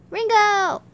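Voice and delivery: excited shout for the pet. Acted. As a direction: yell the name across the room. Calling out the pet's name.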